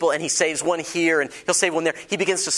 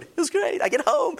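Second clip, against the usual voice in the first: much higher, bright with excitement; thrilled, pitched way up; excited. Real, not acted. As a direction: relive the thrill of the first car. Excited car story.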